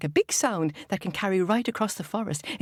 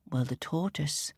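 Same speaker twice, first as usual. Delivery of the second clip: ordinary delivery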